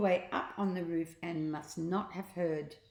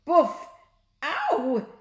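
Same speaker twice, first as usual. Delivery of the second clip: loud, high boof and ow; comic bonk and yelp; whack and ow; 9 semitones higher, 9 dB louder than usual. A narrator's reading. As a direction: hit the sound then yelp. Toy whack and pain cry.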